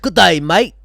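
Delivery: mock Aussie accent, light and playful; a hammed-up Aussie hello. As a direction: greet like a cartoon Australian. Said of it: "your accent sounds more British and Aussie".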